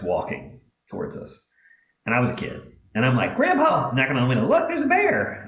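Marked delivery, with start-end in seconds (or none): 3.4-3.9 put-on high, excited child's call; child spotting a bear, calling grandpa in excitement; excited kid. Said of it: "And I'm excited". 4.5-5.5 put-on much higher, thrilled little-kid voice; child showing grandpa the bear, thrilled; excited kid. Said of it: "And I'm excited".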